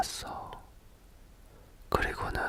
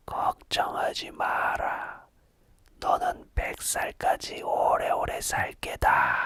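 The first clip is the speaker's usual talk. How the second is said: mock mountain spirit. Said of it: "신령님 흉내를 낸 거지".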